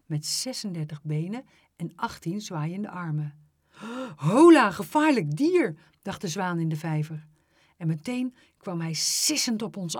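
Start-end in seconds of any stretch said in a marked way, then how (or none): 4.2-5.7 loud, high startled cry; startled, raised-voice warning; alarmed exclamation. A narrator's reading. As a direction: exclaim at sudden danger. Swan alarmed by approaching beast.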